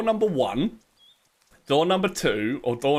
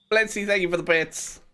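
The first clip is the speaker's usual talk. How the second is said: ordinary delivery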